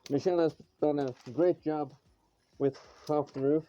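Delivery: muffled behind a respirator mask; through a respirator. Real, not acted. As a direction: talk through a face mask. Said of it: "I don't know if you can hear me through the respirator".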